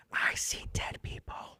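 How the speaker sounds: slow, unvoiced imitation whisper; whispered movie quote. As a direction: whisper it slowly like the scared movie kid. Quotes famous movie line.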